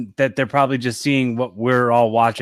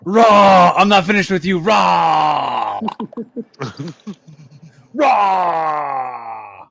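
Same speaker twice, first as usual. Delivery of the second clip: Braun Strowman impression; much slower than usual. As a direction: bellow like a monster wrestler. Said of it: "my Braun Strowman impression".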